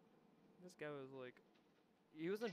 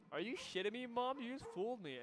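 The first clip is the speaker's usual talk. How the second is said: high-pitched, louder mock outrage; retold indignant disbelief; 9 semitones higher, 5 dB louder than usual. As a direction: protest like you've just been pranked. Retold outrage at mom's prank.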